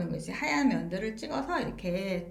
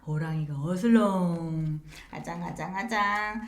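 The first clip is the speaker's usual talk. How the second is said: prowling tiger voice; 5 semitones lower than usual. Shown as a demonstration. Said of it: "호랑이 사인은 두 손으로".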